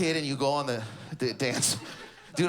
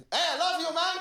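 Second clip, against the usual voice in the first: high, stiff, blurted out; awkward dad; 11 semitones higher than usual. Retold or imitated. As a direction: blurt it like a dad who can't say it. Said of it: "a guy just standing there".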